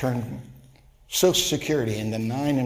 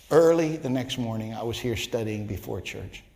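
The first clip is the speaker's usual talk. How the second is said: ordinary talk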